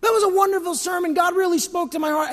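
hammed-up admiring compliment; mock gushing churchgoer. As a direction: gush like a fan after the service. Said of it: "Everyone may tell you".

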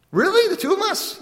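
very high, amazed wife; delighted surprise. Retold or imitated. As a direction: gasp it out like she can't believe it. Wife surprised by dinner invitation.

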